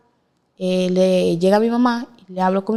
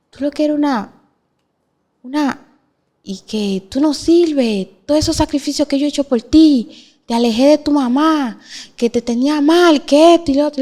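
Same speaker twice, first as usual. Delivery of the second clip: a mild take on a furious partner's rant; retold angry rant; 6 semitones higher than usual. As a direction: rail at her as the man who hurt her. Abuser yelling while dragging her.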